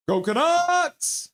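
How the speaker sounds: high, slow, stretched team cheer; a long, high-pitched rallying cry; drawn-out cheer. Pretend. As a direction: belt it like a fan in the stands.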